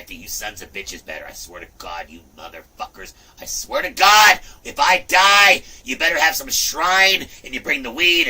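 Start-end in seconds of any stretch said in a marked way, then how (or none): 3.4-8.3 loud, high, slow yelling rant. Real, not acted. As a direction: bellow the demand at them. Ranting demand for a shrine.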